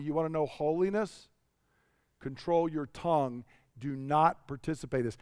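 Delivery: low, soft, grave retold word from God; hushed admonition. God instructing the preacher about his tongue, hushed admonition. Voicing God's quiet personal admonition.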